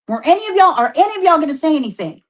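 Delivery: high, fast, pressing challenge; heated demand. Real, not acted. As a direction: demand an answer, pressing hard. Heated accusation.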